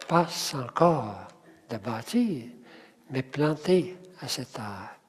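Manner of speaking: quiet groups with pauses after "encore", "bâtir", "planté"; stress on last syllables. Shown as a demonstration. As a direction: lengthen "-core", "-tir", "-té", "âge". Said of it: "mettre l'accent". (groupes rythmiques, accent final sur « encore », « bâtir », « planté », « âge »)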